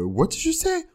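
high squeaky mock drama line; shrill soap opera diva; sitcom diva voice.

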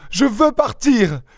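very high, slow wailed demand; desperate insistence. A narrator's reading. Young wife struggling to leave, desperate insistence. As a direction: wail it high, drawing it out. Frantic woman insists on leaving.

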